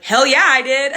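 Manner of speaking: high, loud gleeful boast; proud hell yeah. Retold or imitated. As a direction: boast it happily. Proud customs confession.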